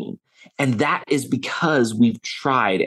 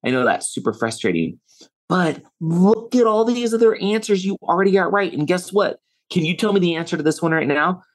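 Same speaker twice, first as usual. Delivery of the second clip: encouraging; 6 semitones higher than usual. Retold or imitated. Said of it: "I was like".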